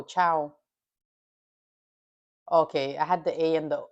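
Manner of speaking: ordinary talk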